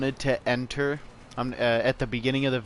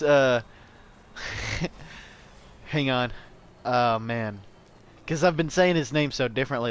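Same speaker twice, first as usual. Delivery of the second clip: normal talking voice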